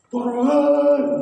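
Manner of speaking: sharp high shout to get out; shouted order. Retold or imitated. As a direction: bark it like a guard at the car. Guards order him out of car. (teriakan penjaga menyuruh turun)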